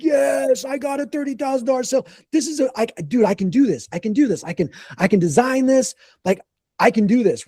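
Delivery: high-pitched, giddy self-talk; excited, tumbling self-encouragement; retold excitement. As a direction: replay your thrill at landing the sale. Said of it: "So I was all excited".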